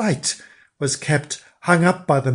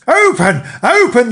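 high, loud furious scream; frenzied high scream; witches screaming; 9 semitones higher, 8 dB louder than usual. A narrator's reading. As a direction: shriek it at the door like raging witches. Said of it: "they screamed".

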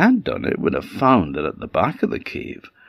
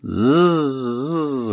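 long buzz, pitched up; bumblebee buzz; 6 semitones higher than usual. Acted. Bee flies off to its hive, buzzing. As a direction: buzz away like a departing bee. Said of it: "buzzed off back to its hive".

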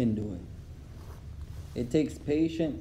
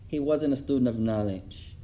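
calm sheikh; 5 semitones lower, 4 dB quieter than usual. Retold or imitated. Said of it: "So the Shaykh said".

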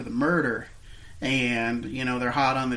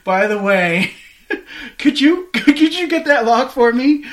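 laughing; 9 semitones higher, 10 dB louder than usual. Real, not acted. Laughing retelling of the guy's request.

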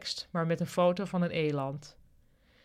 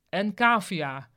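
slow, louder name call; announcing; 5 dB louder, slower than usual. A narrator's reading. The big boss honours departing Kavia, announcing. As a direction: draw out the last honouree's name.

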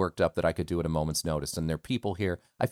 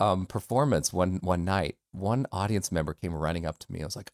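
ordinary talk

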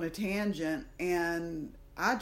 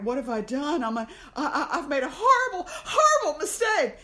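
rising into a near-shouted, laughing wail of panic; much higher and louder, panicking that it's a horrible mistake; panicked inner voice; 8 semitones higher, 8 dB louder than usual. Retold or imitated. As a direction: spiral from worry to a cracked half-laughing cry. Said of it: "There's this one thought over here going".

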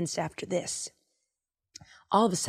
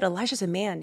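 usual speaking voice

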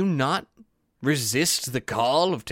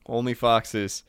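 ordinary talk

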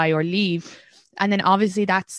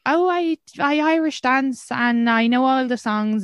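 higher, slower, gushing Irish-American fan; mock eager Irish American; 7 semitones higher than usual. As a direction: ham up a proud Irish-American tourist. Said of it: "they'll be like".

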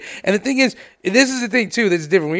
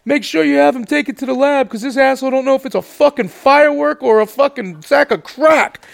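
mocking jeer; 7 semitones higher than usual. Cousin mocking cop to colleagues.

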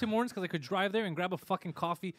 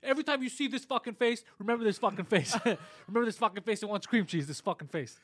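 much higher, half-laughing fake fury; mock belligerent rant; 5 semitones higher than usual. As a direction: rant like an offended customer, laughing through it. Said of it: "Marvin's like".

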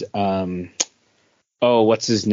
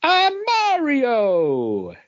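put-on high, slow little-kid voice; drawn-out squeaky imitation of a toddler; dad imitating his son pretending to be Mario; kid playing Mario; 14 semitones higher, much slower than usual.

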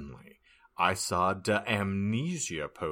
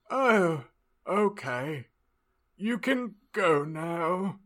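higher, slow professor voice; Slughorn impersonation; 9 semitones higher, slower than usual. Acted. Professor letting the student go, high slow impersonation. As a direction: play the professor, high and drawn out. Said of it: "Slugborn impersonation".

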